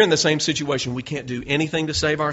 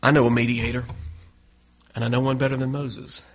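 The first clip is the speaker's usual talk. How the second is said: ordinary talk, nothing marked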